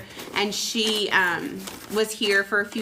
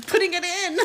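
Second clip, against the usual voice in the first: high-pitched, spilling into a laugh; playful eagerness; 9 semitones higher than usual. Real, not acted. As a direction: say it high, about to crack up. Playful impatience, breaks into laughter.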